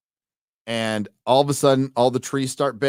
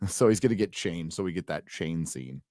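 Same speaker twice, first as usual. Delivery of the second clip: normal talking voice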